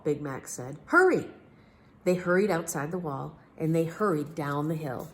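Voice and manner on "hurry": much higher, louder urgent call; Big Max voice. A narrator's reading. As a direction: squeak it out as eager Big Max. Big Max urges the king.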